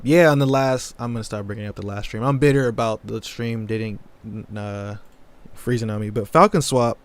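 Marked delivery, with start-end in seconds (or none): 6.3-7.0 suddenly loud, blurted out. Real, not acted. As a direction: punch it out too loud. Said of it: "I got too loud right there".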